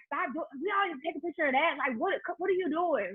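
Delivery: lightly voiced exasperated husband; imitating husband. Wife mimicking husband fussing about her photos. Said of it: "He was like".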